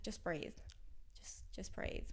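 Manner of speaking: soft whispered reassurance. Retold or imitated. Chris calming her through suicidal days, whispered. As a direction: breathe it out as a whisper. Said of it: "he's just like".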